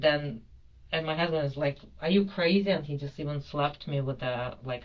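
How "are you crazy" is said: loud, high incredulous question; retold outraged disbelief. Husband rebukes wife after bomb remark, retold outrage. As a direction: imitate an appalled telling-off.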